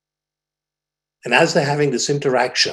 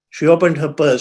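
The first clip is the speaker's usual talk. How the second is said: ordinary talk, nothing marked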